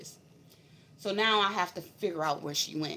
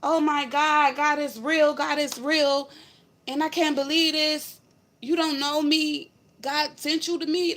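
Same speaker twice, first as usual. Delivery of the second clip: retold tearful disbelief; 8 semitones higher, 5 dB louder, slower than usual. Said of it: "Like, she was crying".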